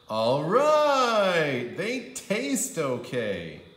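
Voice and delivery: a drawn-out reluctant okay; grudging approval. A narrator's reading. Andrew testing the pajamas, grudging drawn-out approval.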